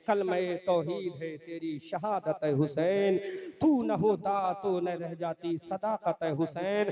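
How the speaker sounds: loud rhythmic devotional recital, phrases stretched; verse declamation. Acted. Preacher praising Husain in couplets, ringing declamation. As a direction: proclaim each couplet forcefully, easing into a sung close.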